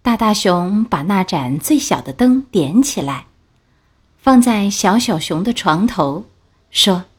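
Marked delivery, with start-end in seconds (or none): none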